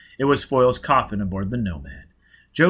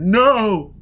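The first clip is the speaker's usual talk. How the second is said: high, loud refusal; denial; 11 semitones higher, 8 dB louder than usual. A narrator's reading. Foyle refusing the truth about his face, loud. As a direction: reject it outright.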